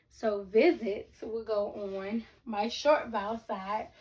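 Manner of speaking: ordinary talk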